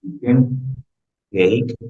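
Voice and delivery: normal talking voice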